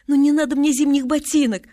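put-on high, loud child's bargaining; pleading protest. A narrator's reading. Girl bargains with her family, loud and pleading.

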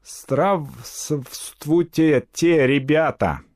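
very slow, high foreigner's mangled Russian; halting foreign accent. A narrator's reading. Spanish woman greets the children, halting foreign accent. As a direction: spell it out laboriously with a foreign accent. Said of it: "с расстановкой, коверкая, как все иностранцы".

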